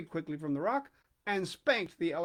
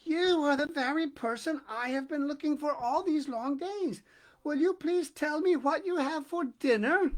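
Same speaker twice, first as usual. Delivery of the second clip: high, eager, panting plea; breathless eager elephant's child; 8 semitones higher, 3 dB louder than usual. A narrator's reading. Elephant's child begging the crocodile, breathless and eager. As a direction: gasp it out, overjoyed to find him. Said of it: "breathless and panted".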